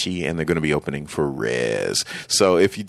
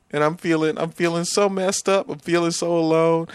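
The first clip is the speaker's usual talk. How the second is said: mock lament; 11 semitones higher than usual. Mock lament over broken music.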